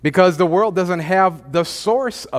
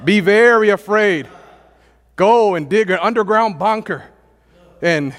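mock doom preacher shouting; mock alarm; 4 semitones higher than usual. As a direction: ham up a doomsday warning. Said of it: "what did the Lord say".

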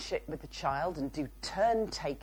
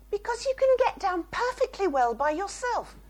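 much higher, louder self-righteous retort; indignant parent; 5 dB louder than usual. Pretend. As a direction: insist you're right, high and sharp. Hypothetical blaming the child.

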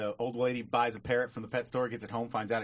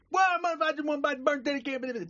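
very high, mangled protest; ranting garbled customer; 3 dB louder than usual. Acted. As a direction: rant unintelligibly on the way out. Customer storming out in joke.

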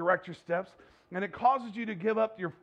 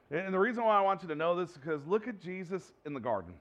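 normal talking voice

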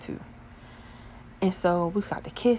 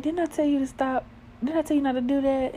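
higher-pitched imitation of a telling-off; mock scolding; 8 semitones higher, faster than usual. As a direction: imitate a partner's chiding. Voices partner's playful scolding.

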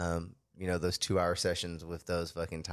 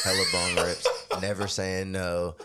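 laughing; 5 dB louder, slower than usual. Real, not acted. Teasing friend about teen self.

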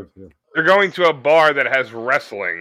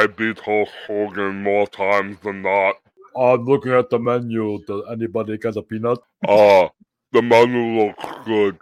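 lumber through it like a huge wrestler; Andre the Giant; 2 semitones lower than usual. Pretend. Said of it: "It's called Andre the Giant".

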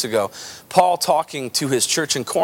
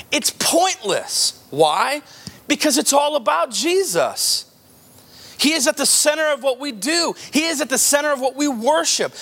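high, driving preacher voice, building; preaching at full force; 9 semitones higher than usual. Acted.

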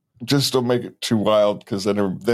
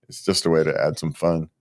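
ordinary talk

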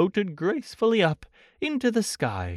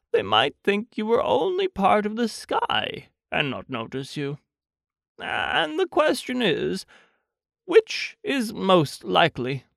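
thoughtful storybook bear; 3 semitones higher than usual. Acted. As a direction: ponder aloud like an earnest little bear. Voices Pooh pondering the balloons.

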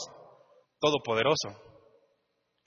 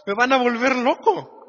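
desperate outburst; 5 semitones higher, 4 dB louder, slower than usual. Retold or imitated. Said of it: "Y dice".

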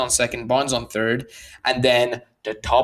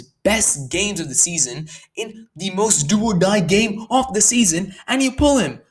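worked up; 7 semitones higher than usual. Real, not acted. Ranting to viewers about the manager's pitching change, getting worked up. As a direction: voice climbing as frustration boils over. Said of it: "sorry, I'm getting a bit worked up".

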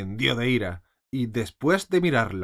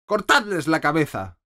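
loud, higher death sentence; angry order; 6 semitones higher, 6 dB louder than usual. A narrator's reading. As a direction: command the beheading coldly and loud. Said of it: "ordenó". (orden de muerte, alta y fuerte)

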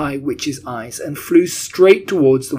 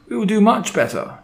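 high-pitched cowed follower; high, eager-to-please reply; murmuring underlings; 8 semitones higher, 3 dB louder than usual. A narrator's reading. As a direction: assure the boss in a high, anxious voice. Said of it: "murmured the audience".

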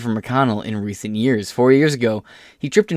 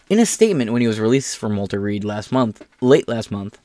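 ordinary talk

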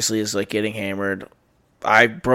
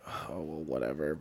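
resigned mutter; 8 semitones lower, 17 dB quieter than usual. Retold or imitated. Shrugging it off.

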